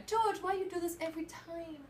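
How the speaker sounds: lightly put-on fan voice pleading with George; fan accent. Fan pleading with George in a lightly put-on accent. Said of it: "I don't know why you went into that accent".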